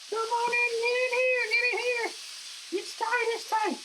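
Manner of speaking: put-on baby the last piece into the pan; coaxing squeaky voice. Cook coaxing the last steak finger into a tight pan, high squeaky pleading.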